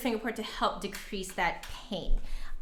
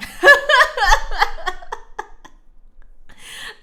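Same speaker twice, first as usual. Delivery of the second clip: loud laugh; 14 semitones higher, 10 dB louder than usual. Real, not acted. Laughing at the stream name.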